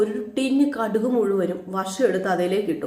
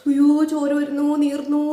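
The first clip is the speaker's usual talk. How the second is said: mock wail; 5 semitones higher, slower than usual. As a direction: ham up a dramatic cry of pain. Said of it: "നീ ഇങ്ങനെ കരയുന്നത്". (ഉറക്കെ ഉയർന്ന സ്വരത്തിൽ നാടകീയമായി കരയുന്നു)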